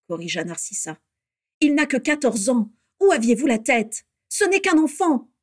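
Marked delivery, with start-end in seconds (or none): 1.6-5.3 high, loud protest; indignant reproach. A narrator's reading. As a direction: reproach them for endangering a child. Sister indignantly reproaches the father. (reproche indigné)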